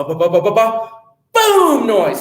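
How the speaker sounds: bumping ba-ba-ba then a high loud boom; noise imitation. Storyteller imitating the noise upstairs, rising to a loud boom. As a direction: mimic footsteps then a crash.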